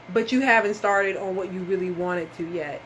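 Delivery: ordinary delivery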